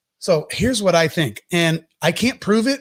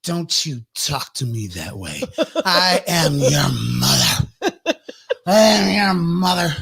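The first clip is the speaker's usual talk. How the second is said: put-on indignant drunk mom; slower than usual. Tipsy Mary scolding Jesus, slow and indignant. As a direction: scold him like a drunk mother. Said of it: "she's like".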